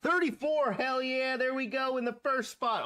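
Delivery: real rising excitement; excited. Real, not acted. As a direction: cheer the lucky number. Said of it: "I'm getting excited now".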